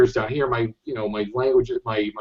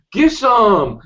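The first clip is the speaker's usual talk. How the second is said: guttural shout, pitched up; guttural urban slang; 10 semitones higher, 4 dB louder than usual. Retold or imitated. Imitating how the Threads kids talked, guttural and high. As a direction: throw out a rough, degraded street call. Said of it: "this guttural, that urban".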